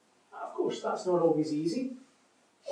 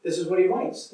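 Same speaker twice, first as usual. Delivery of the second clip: ordinary talk, nothing marked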